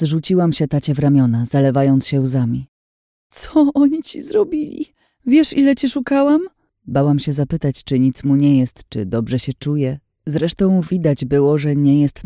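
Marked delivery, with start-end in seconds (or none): 3.3-6.4 very high, anguished outpouring; tearful distress. Acted. Daughter embraces her captive father, tearfully distraught. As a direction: cry out to him, overwhelmed and high-pitched.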